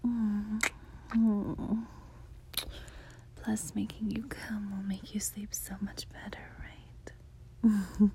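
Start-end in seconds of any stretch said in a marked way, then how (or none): 3.4-8.1 whispering. Acted. Whispers throughout, intimate.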